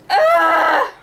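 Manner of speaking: high loud squeal of recognition; excited squeal. Real, not acted. Excited squeal remembering a childhood movie.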